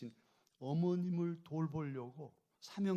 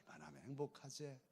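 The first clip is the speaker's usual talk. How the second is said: quiet; 7 dB quieter than usual. Shown as a demonstration. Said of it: "여러분 행복하신가요?".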